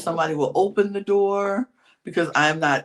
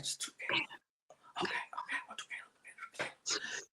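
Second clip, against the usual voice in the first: whispering; 20 dB quieter, faster than usual. Retold or imitated. Retelling whispered plotting.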